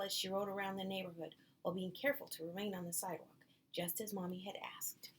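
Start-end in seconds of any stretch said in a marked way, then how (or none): none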